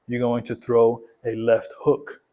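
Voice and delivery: usual speaking voice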